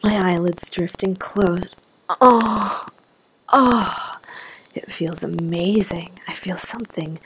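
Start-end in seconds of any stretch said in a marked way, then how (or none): 2.2-4.2 two higher, louder moans; moaning. A narrator's reading. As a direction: moan out each sound, falling away. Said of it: "and I moan".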